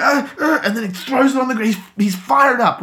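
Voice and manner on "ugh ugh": put-on much higher kid's effort groans; frustrated child grunts. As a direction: grunt like a boy fighting a stuck lid.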